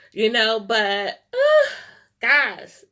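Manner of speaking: real crying, high and loud on the break; the voice cracks into a sob; crying out, choked with emotion. Real, not acted. Overcome thanking the singer.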